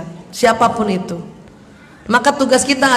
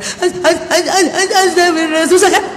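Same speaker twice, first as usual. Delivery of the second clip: choked, stammering mock sobs, higher pitched; struggling tearful istighfar; 5 semitones higher, much slower than usual. Grieving widow told to say istighfar, acted struggling through sobs. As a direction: imitate someone crying too hard to get the phrase out. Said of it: "susah juga disuruh istighfar".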